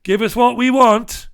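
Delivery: loud, high, hammed-up demand; mock Harry confronting Queen. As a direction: bark it at an old adversary, exaggerated. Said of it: "he's gonna face up to the Queen and say".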